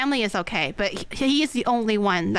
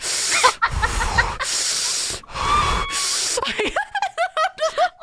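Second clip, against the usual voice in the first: long high helpless laugh; real laughter. Real, not acted. Telling the story of her brother: helpless laugh.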